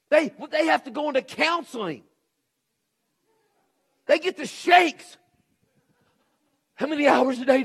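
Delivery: loud, high preaching, driving every line; fiery preaching. Acted. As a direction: preach it hard to the congregation. Preacher at full force.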